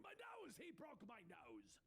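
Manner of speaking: high wail, then drawn-out complaint; hammed-up wounded villain cry; shouting in pain. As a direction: squawk in hurt outrage, falling away.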